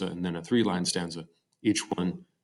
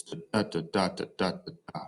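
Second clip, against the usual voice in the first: sing-song "da" syllables, fading softer at the end; bouncing rhythm; 3 dB quieter than usual. Shown as a demonstration. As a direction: alternate weak and strong "da" beats. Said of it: "back and forth between stressed and unstressed".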